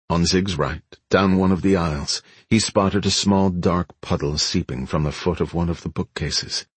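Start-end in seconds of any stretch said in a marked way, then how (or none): none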